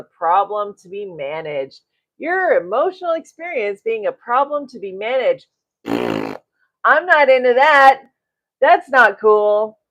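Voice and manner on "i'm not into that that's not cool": firm disapproval. Real, not acted. Emphatic disapproval.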